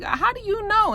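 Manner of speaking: high-pitched mock wifely challenge; challenging. Wife doubting Andre on the phone, challenging. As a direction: throw the question back, voice way up.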